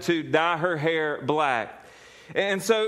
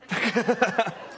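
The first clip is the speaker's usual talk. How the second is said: laughing at own joke; 4 semitones higher than usual. Real, not acted.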